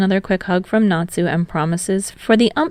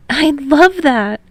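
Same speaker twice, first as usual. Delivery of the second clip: higher, slow and delighted; higher Hinata, delighted; cheerful enthusiasm; 8 semitones higher, 4 dB louder, slower than usual. Acted. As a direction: stretch it out with happy enthusiasm. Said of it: "with his usual cheerful enthusiasm".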